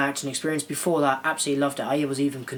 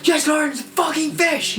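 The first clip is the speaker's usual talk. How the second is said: high, louder hammed-up yell; mock shout; 13 semitones higher, 5 dB louder than usual. Classmate yelling the fish reply back, hammed-up high shout. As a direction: belt out the punchline.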